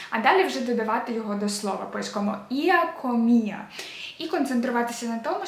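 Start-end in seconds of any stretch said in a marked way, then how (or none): 2.5-3.7 «іа комія» slow and loud, stress on each І; one clear stress. Shown as a demonstration. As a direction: teach «іа комія» slowly, leaning hard on the І. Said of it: "має бути один наголос, чіткий".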